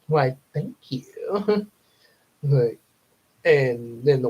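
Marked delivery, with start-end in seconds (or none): 0.1-1.7 laughing reply. Retold or imitated. Date answers in dream.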